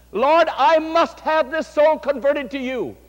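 slow, high and loud plea to the Lord; fervent prayer. Retold or imitated. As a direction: pray it out with rising fervour. Pastor voices his own prayer.

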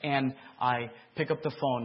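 ordinary talk

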